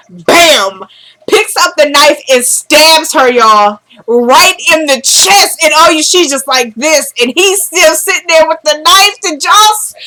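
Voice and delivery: high, animated climax of a recap; shocked excitement. Real, not acted. Recapper reliving the stabbing for viewers, high shocked excitement. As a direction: build from a bang into high-pitched disbelief.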